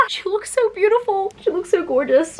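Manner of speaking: starry-eyed high praise; gushing. Real, not acted. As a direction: coo over the picture. Gushing over character art.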